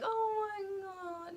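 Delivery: choked, slow, hushed oh my God; tearing up while the song plays; tearful. Real, not acted. Watching Rewrite the Stars, a choked tearful oh my God. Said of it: "I was, like, sobbing".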